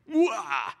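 loud, high cry of exasperation; mock enraged wail. Preacher ends his imagined tirade, mock enraged yell. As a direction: cap a hammed-up rant with a wail. Caps the mock angry rant.

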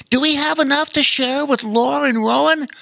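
high critter voice, asking kindly; otter voice. A narrator's reading. Otter asking Bear King about sharing, high voice. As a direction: voice the otter, thinking of friends.

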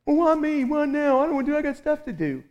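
high, fast mock whining; whiny victim. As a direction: complain like a put-upon kid. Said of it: "I chose to be a victim".

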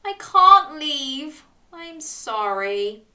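slow, regretful apology; sad. A narrator's reading. Dog saying he can't leave, slow and sad. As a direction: draw it out with regret, sinking at the end. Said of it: "he said sadly".

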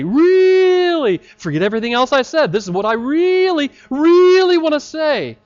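hesitant, fawning rush of empty piety; mock rambling prayer. Preacher mocking a rambling pious prayer, fawning and hurried. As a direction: parody a wordy, apologetic prayer.

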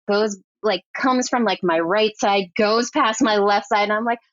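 usual speaking voice

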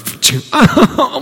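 a sudden high burst of laughter; short high laugh. Real, not acted. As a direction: laugh out at the joke. Laughs after the spider joke.

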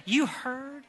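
ordinary delivery

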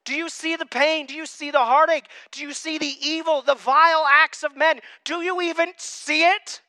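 high, loud desperate questioning of God; a believer crying out to God, loud and pleading; pleading prayer. Retold or imitated. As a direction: beg God to look at the pain. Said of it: "even I have said it".

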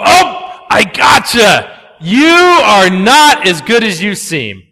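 loud, triumphant mock shout; gloating gotcha. Pastor acts a judgmental person catching someone's sin with a loud gloat. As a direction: pounce on the slip with glee.

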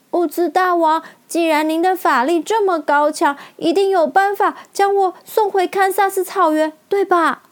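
音调很高的小女孩嗓音; 小女孩期待地央求大王; 满怀期待的小女孩. A narrator's reading. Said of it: "充满期待地看着". (充满期待地问)

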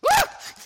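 sudden high yelp of fright; mock-startled shriek; startled scream. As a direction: shriek like you've been caught off guard. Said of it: "she literally did this".

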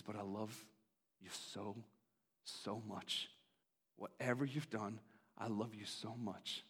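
whisper-close tenderness to the listener; tender God. Acted. God's tender answer.

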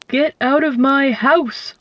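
slow, lightly voiced owl roar; angry owl at the mouse, a mild slow roar. A narrator's reading. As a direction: drag out a mild mock roar. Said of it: "roared the owl".

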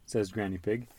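ordinary delivery

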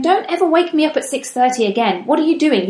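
cross with son. Retold or imitated. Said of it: "I'm cross with my son".

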